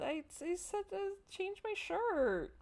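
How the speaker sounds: high, slow whine; a player whining that the game ignores her, high and slow; whiny complaint. Real, not acted. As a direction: plead with the game, drawn out and high.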